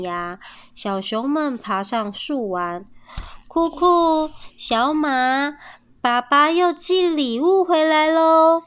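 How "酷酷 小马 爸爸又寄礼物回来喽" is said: put-on high, loud call to the kids. A narrator's reading. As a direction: call them from across the yard. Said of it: "妈妈呼唤着".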